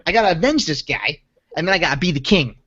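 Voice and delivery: Long Island wise-guy storyteller; Joe Pesci. Acted. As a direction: play a touchy, challenging mobster with a Long Island accent. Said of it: "a pretty stellar Joe Pesci thing".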